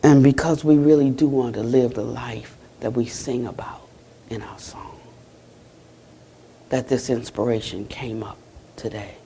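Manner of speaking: low, hushed dharma-talk close; closing blessing. Real, not acted. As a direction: offer a soft, low blessing with long pauses.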